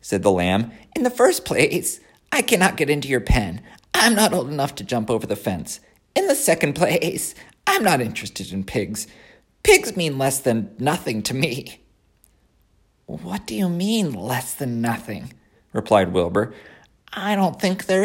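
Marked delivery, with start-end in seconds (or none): 0.9-11.8 put-on high, haughty young lamb; a lamb looking down on a pig; snooty lamb voice. The lamb refuses. 13.1-15.3 high, whiny piglet voice; a disappointed young pig. Acted. Wilbur questions the lamb.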